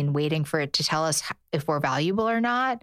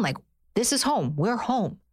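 ordinary talk, nothing marked

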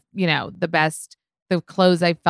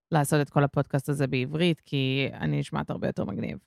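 cooler Hebrew voice; 6 dB quieter, much faster than usual. Shown as a demonstration. Showing listeners her cooler Hebrew self, laid-back. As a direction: toss it off coolly in Hebrew. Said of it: "I am so much cooler in Hebrew".

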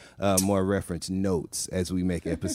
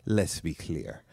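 put-on [name] accent; 5 semitones lower, 8 dB quieter, slower than usual. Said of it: "my [name] accent is pretty good".

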